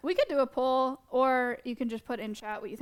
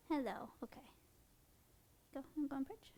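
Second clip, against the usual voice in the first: normal talking voice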